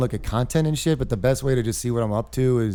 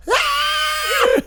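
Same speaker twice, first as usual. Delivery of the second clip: very high loud shriek; high, loud terrified scream imitation; kids screaming; 5 dB louder than usual. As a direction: shriek like scared little girls. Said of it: "they all went".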